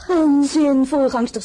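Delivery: loud, high outburst; scoffing dismissal. Acted. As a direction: dismiss it with a sudden loud cry. Scoffs at fear of predecessors. (luid en hoog, wegwuivend)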